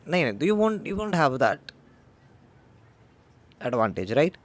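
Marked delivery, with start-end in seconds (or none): none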